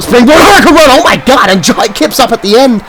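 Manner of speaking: high, loud burst on hurricane run; excited shout. Real, not acted. Commentator calling Marie's springboard move, excited shout. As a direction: erupt as she flies.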